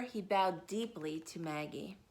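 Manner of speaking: ordinary delivery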